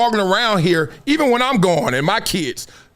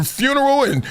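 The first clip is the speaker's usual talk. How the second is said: ordinary talk, nothing marked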